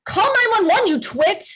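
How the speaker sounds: high-pitched frantic command; panicked order. Acted. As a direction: order the waitress in a panic, voice shooting up. Wife screams after husband collapses.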